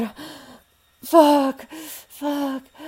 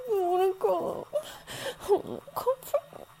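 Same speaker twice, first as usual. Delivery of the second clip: crying plea; 4 semitones higher, slower than usual. Acted. Crying, second slower higher.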